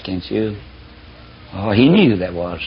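ordinary talk, nothing marked

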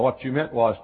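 ordinary talk

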